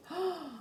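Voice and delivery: quick dramatic gasp; sharp gasp. Acted. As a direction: gasp for the kids. Reader gasps at the threat.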